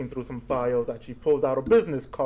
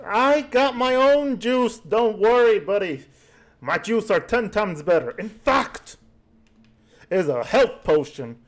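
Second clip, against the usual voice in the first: gnome voice; 7 semitones higher, 5 dB louder than usual. Acted. Gnome brushing off nurse.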